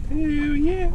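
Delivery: high, loud rising cheer; excited whoop. Real, not acted. Angler cheering a good fish, excited whoop. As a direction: whoop it out for the catch. Excited catch on fishing boat.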